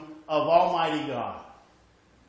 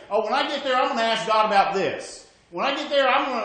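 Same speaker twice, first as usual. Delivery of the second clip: higher and louder, eager plans for heaven; eager imagined promise; 6 semitones higher, 6 dB louder, much faster than usual. Retold or imitated. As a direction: act out the eager believer.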